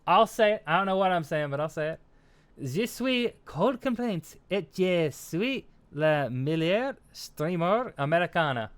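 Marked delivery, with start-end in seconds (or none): 2.6-8.7 slow, halting French in an American accent; careful French attempt with an American accent. Shown as a demonstration. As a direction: sound out French slowly as an American beginner. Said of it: "like to hear your accent".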